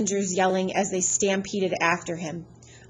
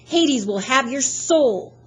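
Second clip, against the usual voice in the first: higher, louder, slow doom; menacing threat; 5 semitones higher, 5 dB louder, slower than usual. Acted. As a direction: curse him with relish. Fury threatens Percy.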